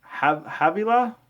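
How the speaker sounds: slow, high questioning try at "Havilah"; unsure pronunciation. Real, not acted. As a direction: sound out "Havilah" carefully. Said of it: "here's another one".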